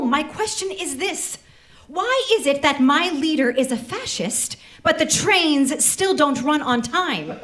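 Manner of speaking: usual speaking voice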